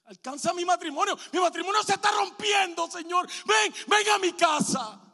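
"ven a mi casa" gritado, alto y fuerte; clamor gritado. Acted. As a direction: clama a Dios a gritos. Prayer crying out for marriage. ("Ven, ven a mi casa" a gritos)

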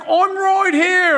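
high, loud, slow English holler; stretched-out mock English accent yell; English accent shout. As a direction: call out in an exaggerated English accent. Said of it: "he was from England".

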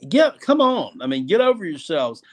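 ordinary talk, nothing marked

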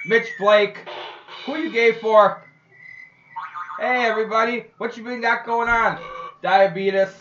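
loud, high mock montage; shouted catchphrases.

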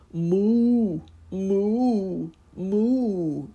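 loud, high, drawn-out cow moos; mournful mooing. Acted. Cow mooing sadly to Uncle Wiggily. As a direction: moo like a sad, lonely cow. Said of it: "in such mournful tones".

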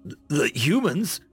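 amazed. A narrator's reading. Captain hears the humans have come, amazed. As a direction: stumble over it in amazement. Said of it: "the captain said in amazement".

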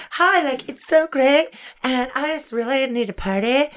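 put-on high, gushing party-girl voice; mock party girl. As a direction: gush it high like a ditzy clubgoer. Acting Cynthia talking her way in.

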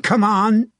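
high, loud taunt; goading battlefield jeer. A narrator's reading. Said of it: "Tom Scarlett jeered at".

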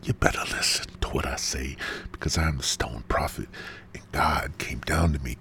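soft, low, whispery mock-prophet voice; hushed warning. Stone Prophet warning listeners to heed him, hushed. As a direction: confide it like a sacred warning.

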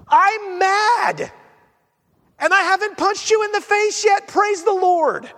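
high-pitched, worked-up confession of anger; acted exasperation. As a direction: declare it high and emphatic, hammed up. Acts out someone honestly admitting anger.

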